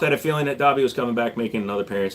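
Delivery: ordinary talk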